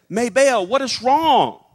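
put-on high, concerned child's question; little boy. Little boy to the crying maid, worried child voice. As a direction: voice a worried eight-year-old. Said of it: "little Fred comes over to her".

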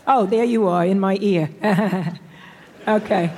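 ordinary delivery

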